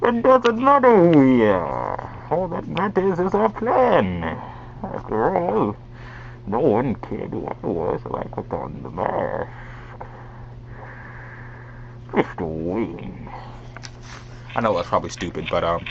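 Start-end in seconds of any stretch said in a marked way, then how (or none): 0.0-5.8 high, stretched-out masked villain; villain voice demo. Shown as a demonstration. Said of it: "talking like this". 6.5-13.3 villain voice demo. Shown as a demonstration. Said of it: "talking like this".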